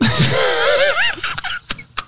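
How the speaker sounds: high laughing squawk; seagull cry. Pretend. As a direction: squawk like a gull. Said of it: "Can you do a seagull noise?".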